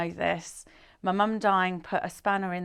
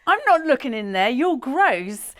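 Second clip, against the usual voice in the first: higher, louder retold protest; acted disgusted refusal; 6 semitones higher, 7 dB louder than usual. Girlfriend to boyfriend about the toilet, acted indignant refusal.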